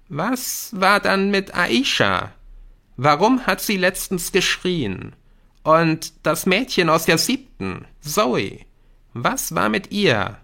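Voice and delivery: insistent schoolboy. Acted. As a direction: press your friend for answers. Jamie presses Nick with questions. (eindringlich nachfragender Schüler)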